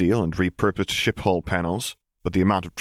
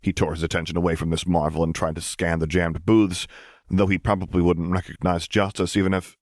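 usual speaking voice

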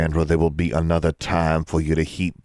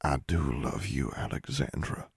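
soft, hushed declaration; whispered; 2 semitones lower, 7 dB quieter than usual. A narrator's reading. Father kneeling by his daughter, whispering. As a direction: murmur it tenderly, fading to a whisper. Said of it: "he whispered".

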